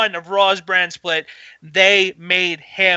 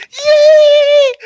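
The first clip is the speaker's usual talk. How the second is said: high, loud fangirl cheer; excited squeal; 9 dB louder than usual. Retold or imitated. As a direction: squeal it with pure joy. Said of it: "I squealed like a girl".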